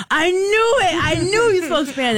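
high, gleeful gotcha; the contractor, high and triumphant, catching her out. Retold or imitated. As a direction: crow it like you were right all along.

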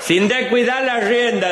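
very high, slow read-out warning; high and slow, nervous instructor; reading the manual. Acted. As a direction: squeak out the warning from the book. Reads manual line.

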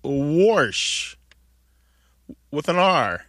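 wash said with an R, like "worsh"; intrusive R. Retold or imitated. Host echoes the caller's mother, intrusive R. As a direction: put an R into "wash". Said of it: "your mother says wash with an R".

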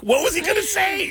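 very high, incredulous cry; high-pitched comic disbelief; retold bafflement. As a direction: exclaim it with pitch shooting up. Retold disbelief at story's punchline.

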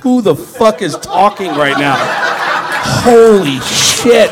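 loud, drawn-out disbelief; hammed-up baffled exclamation, stretched slowly; mock astonishment. Listeners at home hearing the squabble, loud mock disbelief. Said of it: "everyone at home was going".

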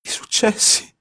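high astonished breathy question; stunned disbelief, high. A narrator's reading. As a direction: gasp out the words in shock. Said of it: "disse sbalordito Giorgio".

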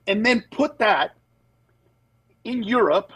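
ordinary talk, nothing marked

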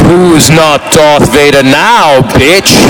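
slow, low villain voice; growing menace, drawn out and deep; Darth Vader. Retold or imitated. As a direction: taunt it as the dark lord, slowly. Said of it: "And I was in character".

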